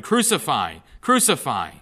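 loud, repeated mob shout. Acted. Chief priests and guards shout for crucifixion, loud. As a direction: hurl each word out. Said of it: "they shouted".

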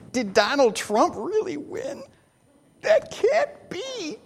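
mock the shock of people who can't believe it; stunned disbelief. Imitating voters doubting the election result, mock disbelief.

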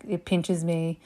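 ordinary talk

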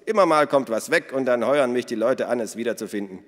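loud, high, hearty woman's voice; boisterous Minna. Acted. Minna tells the children about their father, boisterous. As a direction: play her big and bold.

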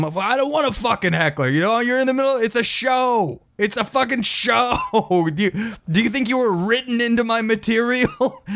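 high-pitched retold tirade at a heckler; mock attacking rant. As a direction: go after him in a high, exasperated imitation. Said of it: "Dice just starts attacking him".

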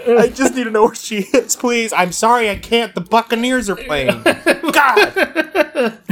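high, laughing pleading voice; pleading woman impression. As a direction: beg through laughter. Acting a scene, laughing.

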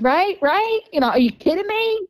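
retold disbelief, pitched well up; mortified. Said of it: "You know, I'm mortified".

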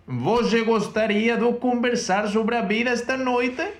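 slow, high Portuguese line, question tune slipping away; second careful try at the Portuguese question; Portuguese phrase again. Shown as a demonstration. Retaking the Portuguese sentence for her teacher, slow and high. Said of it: "se me ha vuelto a ir la interrogación".

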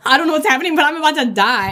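a touch of panicked fear, fast; hammed-up dread of dying; mock panic. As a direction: rush it like you think it's the end. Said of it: "And you're like".

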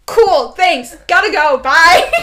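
loud, rushed escape; hurried goodbye. Retold or imitated. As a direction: blurt it out to get away fast. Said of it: "I was like".

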